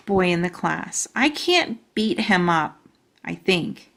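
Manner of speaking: ordinary delivery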